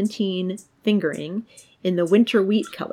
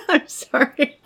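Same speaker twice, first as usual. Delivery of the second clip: laughing; 8 semitones higher, 7 dB louder than usual. Real, not acted. Laughing at a bird.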